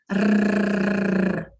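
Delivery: long trilled rrr; rolled r. Shown as a demonstration. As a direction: trill the tongue on the palate. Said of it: "eu vibro a língua no céu da boca".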